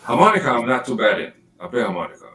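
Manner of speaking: ordinary talk, nothing marked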